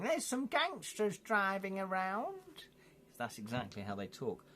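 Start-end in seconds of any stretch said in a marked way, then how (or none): 0.0-2.7 mock old granny, high and slow; playing a fussy old granny, drawn out high. Old grannies reporting gangsters, mock high slow granny voice. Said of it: "from old grannies going".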